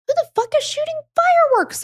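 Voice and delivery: high, bewildered mock exclamation; high-pitched disbelieving outburst; bewildered sister. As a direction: squeak it out like a baffled teen. Confused sister voiced in story.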